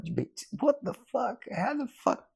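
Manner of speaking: high, squeaky imitation of shocked outrage; mock disbelief. Older surfer stunned at being beaten, high mock disbelief. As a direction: ham up the older guy's stunned reaction.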